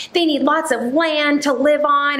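a hint of a lecturing stranger; mocking a preachy commenter. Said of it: "She's like".

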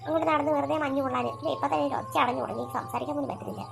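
mother voice. Acted.

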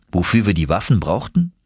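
usual speaking voice

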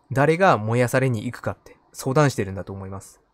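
normal talking voice

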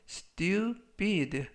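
stupid with the P hit a little harder; strong P. Shown as a demonstration. Teacher stressing the P in stupid, slow and firm. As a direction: say stupid, punching the P sound. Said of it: "P 발음을 약간 강하게".

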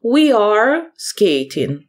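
slow, clear English model sentence. Shown as a demonstration. Teacher reading an example sentence to pupils, slow and clear. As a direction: spell it out slowly for the class. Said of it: "повна форма і скорочена форма".

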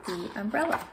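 ordinary delivery, nothing marked

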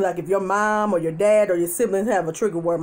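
ordinary talk

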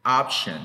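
"Option" with an ah first vowel; loud, clear ah opening "Option"; option vowel demo. Shown as a demonstration. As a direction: model "Option" firmly for learners. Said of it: "esta otra es una A".

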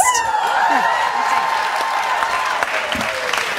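loud, high burst of laughter; big high laugh. Real, not acted. Cohost hears the heels gift and bursts out laughing. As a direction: crack up at the bronzed heels.

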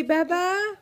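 high rising bye-bye; sing-song prompt. Shown as a demonstration. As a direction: lilt it up for the baby. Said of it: "Can you say".

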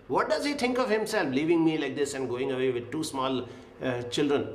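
imitating an out-of-control widow, high and fast; retold outburst. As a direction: rattle off her bitter complaint at speed. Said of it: "She got so out of control".

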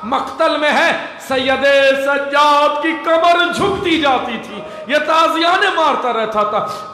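high, impassioned cry held throughout; full-force lament preaching. Acted. Orator telling mourners of the whipping of Sajjad, full-force lament. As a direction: drive the tragedy home in ringing, sustained high tones.

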